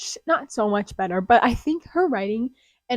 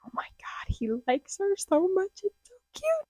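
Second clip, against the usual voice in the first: hushed whisper rising to a much higher excited squeal; gushing reader; 9 semitones higher, 5 dB quieter than usual. Retold or imitated. Reader gushes to herself over the love interest, rising from whisper to squeal. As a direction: whisper it, then squeal the cute part. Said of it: "I was like".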